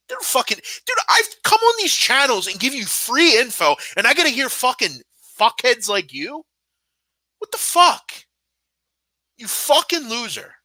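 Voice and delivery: furious rant. Real, not acted. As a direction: blow up at the troll who insulted you.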